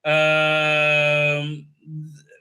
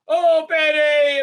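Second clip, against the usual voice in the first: mock wail; 10 semitones higher, 6 dB louder, slower than usual. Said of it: "quoting her".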